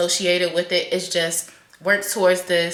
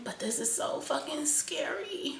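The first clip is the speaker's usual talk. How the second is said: whispered, much softer, fear showing through; scared whisper; 3 semitones higher, 12 dB quieter than usual. Real, not acted. Vlogger admitting to viewers that losing her job is scary, whispered. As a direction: let the fear slip out under your breath.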